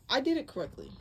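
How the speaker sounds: pleased outburst before a laugh; sudden realization. Real, not acted. Realizes she made no mistake.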